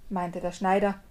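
plain reading voice

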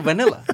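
high, playful echo of "vanilla"; mimicked Australian pronunciation. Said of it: "It's vanilla".

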